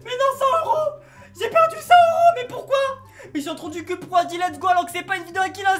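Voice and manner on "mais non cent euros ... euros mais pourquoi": hammed-up whining sob, high; fake-cry over the lost money, laughing through it; mock crying. Big brother fake-crying over the hundred euros he lost, mock wail.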